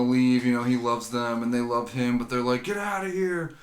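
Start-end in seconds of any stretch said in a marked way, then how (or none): 2.6-3.5 imitated mock yell. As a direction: act out shooing someone away with tough love. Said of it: "like, yelling at him".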